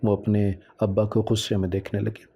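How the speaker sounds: plain reading voice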